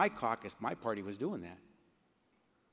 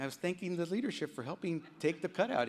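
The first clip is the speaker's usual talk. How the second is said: defensive protest; 8 semitones higher, 6 dB louder than usual. Retold or imitated. Retells his defensive reply to colleagues.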